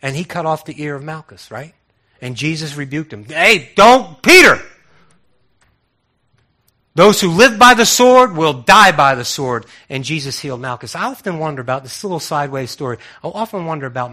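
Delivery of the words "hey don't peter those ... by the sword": stern rebuke. Retold or imitated. Jesus rebukes Peter at arrest.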